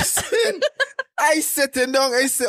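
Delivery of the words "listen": high, laughing "Listen". Real, not acted. Fighting laughter to start his own story, laughing. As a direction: say it through a laugh.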